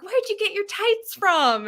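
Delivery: high, drawn-out friendly question; girl running up. Retold or imitated. Girl asking a concertgoer about tights, high imitated eagerness. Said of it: "this girl".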